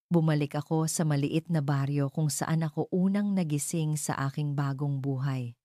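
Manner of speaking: ordinary delivery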